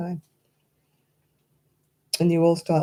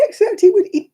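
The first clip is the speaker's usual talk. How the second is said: much higher, crying with laughter; laughing tearfully; 7 dB louder than usual. Real, not acted. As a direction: squeak out through tears of laughter. Laughing while telling.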